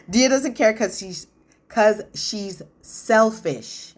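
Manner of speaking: real laughter breaking through the words; cracking up mid-sentence, drawn out; giggling so hard the words come out slowly; laughing. Real, not acted. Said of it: "I can't talk".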